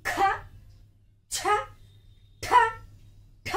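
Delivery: ఖ ఛ ఠ with a strong puff of breath; aspirated letters said from the belly. Shown as a demonstration. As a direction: push ఖ, ఛ, ఠ out from the belly, heavily aspirated. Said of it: "కొన్ని అక్షరాలు ఒత్తి పలుకుతున్నా".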